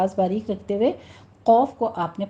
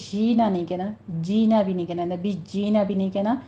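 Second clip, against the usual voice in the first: usual speaking voice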